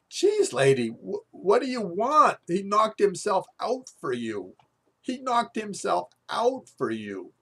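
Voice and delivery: exasperated plea. Retold or imitated. Telling the yelling mom off: loud exasperated protest. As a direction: plead with her, baffled. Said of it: "I say to the woman".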